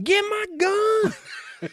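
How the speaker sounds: very high, drawn-out loud yell; mock scream. Husband yelling as the bobcat attacks his wife, screamed. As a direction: scream it out, stretching the words. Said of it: "and then he's screaming".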